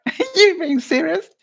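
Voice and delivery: amused high-pitched retort; laughing disbelief. Retold or imitated. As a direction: laugh out the question, half incredulous. Incredulous retold reply, laugh heard.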